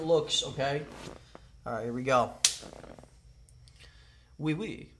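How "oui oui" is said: put-on higher, softer French accent; light mock-French. Player giving an accent clue to a guesser, French accent.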